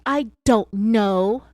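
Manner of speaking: a suspect answering the agent obnoxiously. A narrator's reading. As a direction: drag it out, obnoxious. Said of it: "obnoxiously answered".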